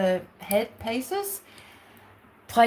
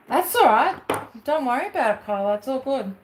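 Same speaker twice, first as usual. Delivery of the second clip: reassuring; 4 semitones higher than usual. Real, not acted. Soothing Kyla after a small mishap, reassuring and high. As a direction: gently tell her it's fine.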